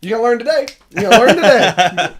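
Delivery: loud, high, hyped-up genie. Retold or imitated. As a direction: yell it like a brash genie. Kevin Hart genie impression.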